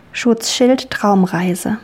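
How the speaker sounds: guided meditation. Acted. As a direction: ease a child into calm. Leads a children's dream journey.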